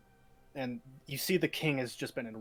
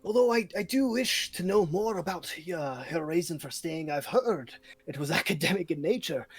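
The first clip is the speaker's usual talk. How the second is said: much higher, louder pompous voice; a fat lord questioning Daenerys, high and loud; 4 semitones higher, 5 dB louder than usual. Acted. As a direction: puff up like a short fat noble. Short fat lord speaks.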